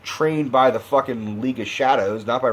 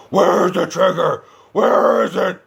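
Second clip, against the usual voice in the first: slow, higher, louder raspy growl; raspy Batman voice; 7 semitones higher, 5 dB louder than usual. Retold or imitated. Mocking Bale's Batman demanding the trigger, raspy growl. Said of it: "Bale's raspy fucking Cookie Monster voice".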